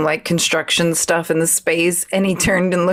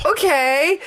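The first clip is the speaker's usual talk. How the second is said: high, uneasy mock acceptance rising at the end; retold hesitant okay, pitched up; awkward reaction; 10 semitones higher than usual. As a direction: imitate someone politely taken aback. Man's awkward reaction, acted out.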